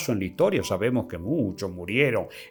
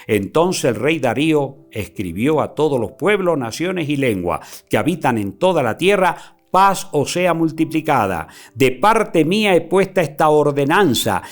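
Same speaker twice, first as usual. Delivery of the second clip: loud, declaiming Bible reading; raised preacher reading; preaching scripture; 5 semitones higher, 6 dB louder than usual. Acted. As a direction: proclaim the verses to the congregation. Preacher reads scripture aloud.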